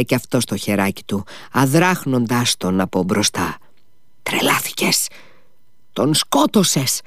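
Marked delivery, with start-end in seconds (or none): 4.2-7.0 high, restrained cry, slowing at the end; mild narrated shout. A narrator's reading. Son rebuking his brother over their father, a restrained shout. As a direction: raise the pitch in reproach, holding back the volume. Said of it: "φώναξε ο Ιβάν".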